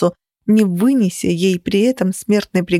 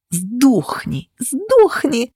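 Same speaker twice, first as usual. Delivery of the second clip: rival spits a hissed curse, the second word flaring high; a snake-like hiss that jumps up on the repeat; hissing, rising to a shriek; 5 semitones higher, much slower than usual. A narrator's reading. Rival hisses at the heroine, the second word shrieked high. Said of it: "шипела сытая змея".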